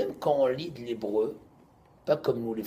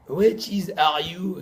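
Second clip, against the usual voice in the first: put-on slow, loud English with a French accent; mock a Frenchman struggling through English, high and loud; 7 semitones higher, 11 dB louder, much slower than usual. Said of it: "comme un Français qui dit l'anglais".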